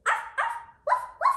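very high squeaky arfs; tiny pup yaps. Pretend. Child playing the little pup with high yaps. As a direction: yip like a tiny puppy. Voicing both puppies.

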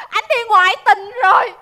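loud crying wail; tearful outcry. Acted. Pregnant wife reveals husband's affair, wailing. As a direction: cry out the betrayal.

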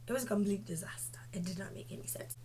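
fast, unvoiced whispered aside. Real, not acted. Confiding to viewers, a fast whisper. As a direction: hiss it like a secret.

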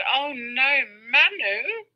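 imitating mother's high-pitched protest; retold motherly dismay, voice lifted high. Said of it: "she said just in that tone".

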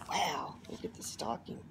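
soft unvoiced whisper of delight. Real, not acted. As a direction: breathe it out in awe, no voice. Admiring gifts while unboxing.

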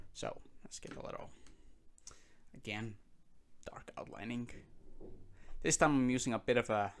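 soft whisper while sketching; hushed, barely voiced narration. Real, not acted.

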